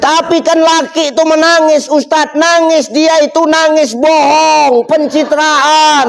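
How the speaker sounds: high, slow whiny protest; mock objection. Woman in the crowd protests to the ustadz, high and whiny. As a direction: whine it up high like a doubting listener.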